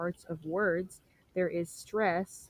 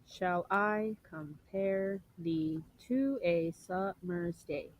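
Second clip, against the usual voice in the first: slow, syllable by syllable, "Shall I compare thee to a summer's day" alternating unstressed and stressed; iambic up-and-down reading; much slower than usual. Shown as a demonstration. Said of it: "pay attention to how I read this".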